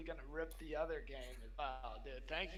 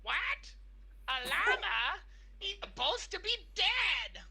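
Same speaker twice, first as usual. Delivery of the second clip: much higher, louder shrieking villainess; Yzma impression; 13 semitones higher, 8 dB louder, slower than usual. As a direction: screech it like an outraged cartoon villainess. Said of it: "I'll let you guys guess".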